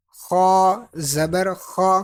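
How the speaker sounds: خا slow and heavy, lips unrounded; full, thick sound. Shown as a demonstration. Teacher modelling heavy letters, خا read full and thick. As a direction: give خا a thick full vowel without rounding the lips. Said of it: "موٹا پڑھیں گے".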